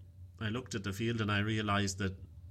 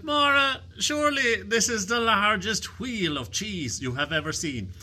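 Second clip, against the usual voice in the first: boastful husband; 12 semitones higher, 9 dB louder than usual. Acted. Kieran boasting to his wife.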